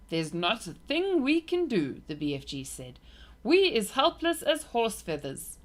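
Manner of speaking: a hint of a big friendly giant confiding; kind giant. A narrator's reading. BFG voiced in story.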